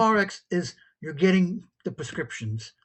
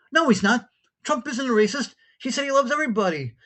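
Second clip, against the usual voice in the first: retold caller protesting, pitched up and pressing; indignant denial; 6 semitones higher, 3 dB louder than usual. Caller defends Trump on radio.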